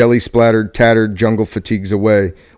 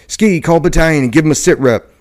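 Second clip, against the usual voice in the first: higher, urgent call to the radio man; yelled; 6 semitones higher than usual. A narrator's reading. Captain yelling to his radio operator, urgent call. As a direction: call out the order in a hurry. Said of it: "yelled to his company radio operator".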